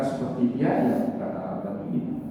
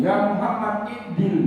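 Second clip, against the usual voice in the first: high, raised call of a rude Bedouin; half-shout from afar; 10 semitones higher, 4 dB louder than usual. Acted. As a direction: call out bluntly to someone far off. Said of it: "setengah teriak itu, Pak, dari jauh dia teriak".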